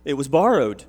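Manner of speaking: loud, high anguished cry. A narrator's reading. Woodcutter crying to Elisha over the lost axe head, loud dismay. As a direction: wail it out in dismay. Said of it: "he cried out".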